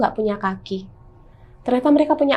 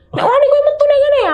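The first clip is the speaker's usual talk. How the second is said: loud, much higher shouted challenge; yelling a Javanese threat into the stairwell; angry dare; 6 dB louder than usual. Retold or imitated. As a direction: dare the ghost to show itself. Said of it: "aku bilang gitu".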